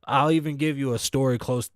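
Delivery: usual speaking voice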